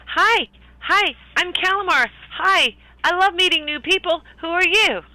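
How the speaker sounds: high, bubbly and eager; cheery character voice. Acted. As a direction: chirp the greeting, way up high. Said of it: "and he says".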